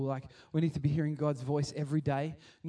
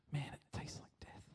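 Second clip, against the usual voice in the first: whispered, much softer gossip; whispered complaint; 16 dB quieter than usual. Retold or imitated. Churchgoer whispering to Pastor Dave about the bad curry.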